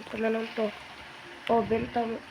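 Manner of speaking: normal talking voice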